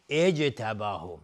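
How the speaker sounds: "اجتباه" slow, opening on a clear i; starting with kasra. Shown as a demonstration. Teacher gives the right start, "اجتباه" slowly as ijtabahu. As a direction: begin "اجتباه" on glottal i: ijtabahu. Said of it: "با کسرهٔ".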